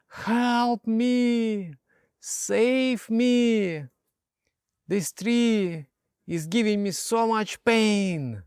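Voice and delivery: high, hammed-up plea, slowing at the end; mock cry for help. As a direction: play the master pleading theatrically. Master acts trapped by tree.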